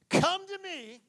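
high, drawn-out beckoning cry; stretched, high-pitched invitation; calling out. Acted. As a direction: call people in from afar. Acting Jesus calling to crowd.

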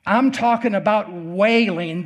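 ordinary talk, nothing marked

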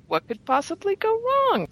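high-pitched, sing-song irony; playfully tempting fate, voice lifted high; mock innocence. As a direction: ask it with knowingly false optimism. Ironic episode recap.

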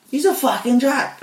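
high, mocking call-out; mock accusing. Workmates exposing him as a Swansea fan, high mocking accusation. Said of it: "they fucking showed me".

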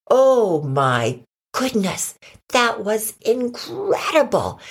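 slow, drawn-out amazement; amazed. Acted. Dogs watch the pine cone fly, amazed.

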